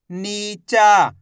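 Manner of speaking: नीचा very slow, loud long आ on चा; drawn-out last letter. Shown as a demonstration. Teacher reading the joined word for students, last letter drawn out. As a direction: stretch both नी and चा, leaning hard on the end. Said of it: "last वाला अक्षर को खींच के पढ़ देंगे".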